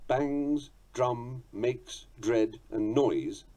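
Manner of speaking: "Bangs", "drum", "makes", "dread", "noise" each said apart, punched; the accented beats, said slowly; stressed words listed. Shown as a demonstration. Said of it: "are those even-numbered accented words".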